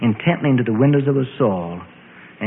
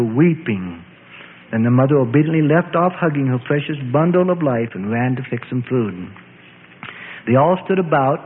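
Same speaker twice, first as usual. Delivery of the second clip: usual speaking voice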